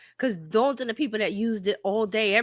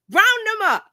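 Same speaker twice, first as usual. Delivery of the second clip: Casablanca officer; 11 semitones higher, 5 dB louder than usual. Retold or imitated. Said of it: "that scene from Casablanca".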